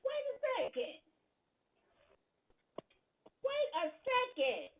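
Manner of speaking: high, loud shocked outburst; stunned wait a second. Real, not acted. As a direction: blurt it out in disbelief. Reacting in shock to clip.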